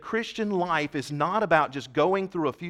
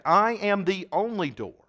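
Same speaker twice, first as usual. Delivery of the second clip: slow and raised, weight on "only door"; emphatic declaration; 5 semitones higher, slower than usual. Retold or imitated. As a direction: spell out "I am the only door" slowly and firmly. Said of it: "that's what he's saying emphatically".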